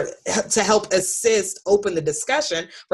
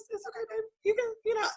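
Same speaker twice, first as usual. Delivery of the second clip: tender mommy voice; 8 dB quieter, much faster than usual. Retold or imitated. Tender voice to son.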